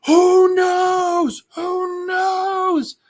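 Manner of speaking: very high, drawn-out mock scream; hammed-up agonized howl; imitated screaming. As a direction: wail each word slowly at the top of your pitch. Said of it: "he's just screaming".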